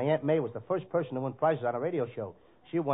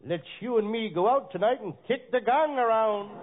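normal talking voice

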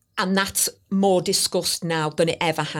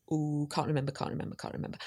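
muttered to herself; 5 semitones lower, 12 dB quieter, faster than usual. Retold or imitated. Retelling frantic name search.

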